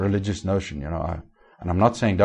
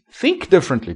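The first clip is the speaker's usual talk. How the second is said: "think" hit hard and higher; stress on think; 7 semitones higher than usual. Real, not acted. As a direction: punch "think" in "Think differently". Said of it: "That's what the Amplified says".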